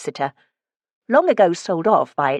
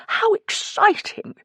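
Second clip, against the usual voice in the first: much higher, breathless burst of delight; slower than usual. A narrator's reading. Morris thrilled by the flamenco idea, delighted gasp. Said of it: "Morris clapped his hands in delight".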